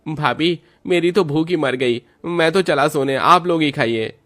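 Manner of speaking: youthful voice declining dinner to sleep; fed-up young man. Acted. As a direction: toss it off like a grumpy younger brother. Narrator voices young brother-in-law.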